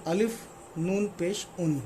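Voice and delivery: अलिफ नून पेश उन spelled out slowly, each letter apart; slow spelling demo. Shown as a demonstration. Teacher slowly spelling उन letter by letter for students. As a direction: sound out every letter slowly, then land on उन. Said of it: "उन कहना हो किसी को तो".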